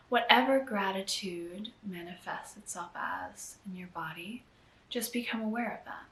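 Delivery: unhurried, hushed meditation lead; guided meditation. Acted. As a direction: gently guide them inward, leaving space between phrases. Leads a guided gratitude meditation.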